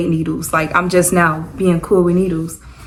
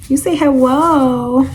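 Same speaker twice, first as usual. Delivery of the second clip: higher cooing voice for the little one; 6 semitones higher, much slower than usual. Real, not acted. Mom talking to her baby.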